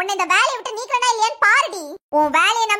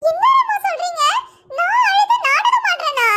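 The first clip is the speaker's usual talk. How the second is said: hurt disbelief; 7 semitones higher than usual. Acted. Wife hurt by husband's accusation. (கண்ணீருடன் அதிர்ச்சிக் கூச்சல்)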